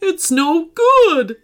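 slow, high character voice for a dog; voiced dog character. A narrator's reading. Phoebe the dog speaks in story.

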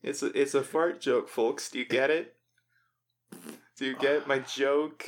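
ordinary delivery

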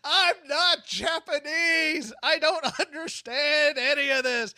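slow, drawn-out panicked yell. Retold or imitated. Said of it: "all he kept yelling was".

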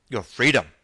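loud, startled echo question; taken aback. A narrator's reading. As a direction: cut in, throwing the word back. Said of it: "Captain Nemo put in".

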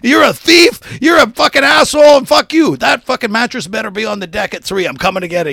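high, very loud mock tirade; full-voiced shouting, acting out an angry text; retold furious rant. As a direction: imitate an enraged friend shouting accusations.

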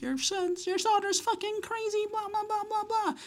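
very high squeaky tattling; hammed-up high-pitched whine trailing into blahs; mock girl voice. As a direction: imitate an outraged girl complaining. Retold girl complaining to dad.

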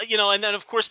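ordinary talk, nothing marked

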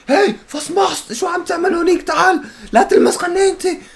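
high-pitched sung call out. Retold or imitated. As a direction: call out high and sing-song. Acting out gym confrontation.

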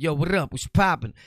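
loud street-style greeting. Shown as a demonstration. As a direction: holler it like greeting someone on the block. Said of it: "When I get to the streets, I'll be like".

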